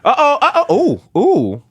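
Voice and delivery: burning mouth on hot tea; sipping hot tea. Pretend. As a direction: wince and gasp over hot tea. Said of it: "this tea is so hot".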